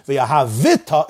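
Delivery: V'ahavita with a clear vowel after V; moving shva in V'ahavita. Shown as a demonstration. Teacher naming the moving shva, voiced in V'ahavita. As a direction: add a beat inside V'ahavita: ha-VI-ta. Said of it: "It's a moving shva".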